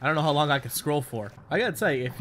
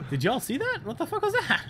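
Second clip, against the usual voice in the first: high shocked question; startled disbelief; 11 semitones higher than usual. Real, not acted. Gamer startled by a doll vanishing in the water. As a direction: squeak out the shock.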